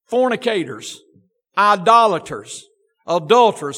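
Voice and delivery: preaching. Acted. Baptist preacher warning his church against condemning, fervent. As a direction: drive the point home to the pews.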